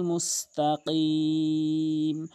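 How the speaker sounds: very slow chanted; Quran recitation. Acted. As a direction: chant it drawn out. (তেলাওয়াতের সুরে)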